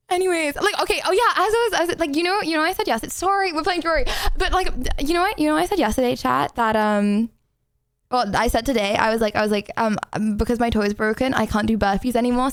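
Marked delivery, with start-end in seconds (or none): none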